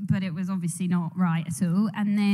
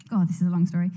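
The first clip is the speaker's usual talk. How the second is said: ordinary delivery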